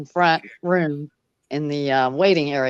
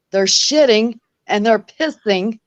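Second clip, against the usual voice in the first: retold rant; 5 semitones higher, 6 dB louder than usual. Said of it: "he was going off".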